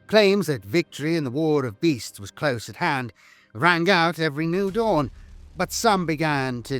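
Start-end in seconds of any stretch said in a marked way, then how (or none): none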